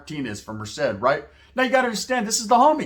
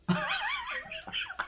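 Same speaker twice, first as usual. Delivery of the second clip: high, quieter cackle of laughter; burst of laughter; 12 dB quieter than usual. Real, not acted. Telling listeners about the scared cat, a high laugh. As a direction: let a squeaky laugh escape at the memory.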